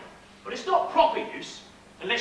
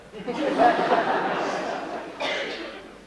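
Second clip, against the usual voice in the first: mocking laugh. The crowd mocks the stranger.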